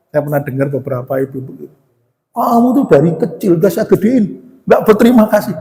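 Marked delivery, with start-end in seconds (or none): 2.3-5.5 high-pitched mock scolding; imitated angry parent. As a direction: play a parent berating an ungrateful child. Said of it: "orang tua kalau lagi marah gitu".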